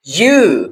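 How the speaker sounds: यू with the vowel drawn out; long U. Shown as a demonstration. Teacher demonstrates the long sound of U, drawn out. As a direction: hold the oo in यू long. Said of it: "इसको लंबा करना है".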